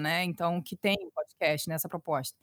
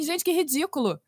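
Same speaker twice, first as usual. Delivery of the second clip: higher-pitched mocking quote of her husband; husband's mock ridicule; 6 semitones higher than usual. Husband hears her recording, imitated dismissively.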